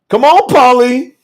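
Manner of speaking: ordinary talk, nothing marked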